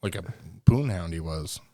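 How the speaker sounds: low, offhand delivery; nonchalant. Real, not acted. Friend describing a TV character's womanizing, nonchalant. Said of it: "It was so nonchalant".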